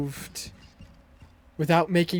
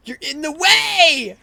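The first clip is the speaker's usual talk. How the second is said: frustrated yelling; 8 semitones higher, 8 dB louder than usual. Real, not acted. Enemy blocks his path.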